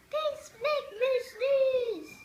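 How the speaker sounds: loud, high, slow lament; hammed-up complaint to the doctor; mock despair. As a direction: wail it dramatically. Wailing the sad truth to doctor.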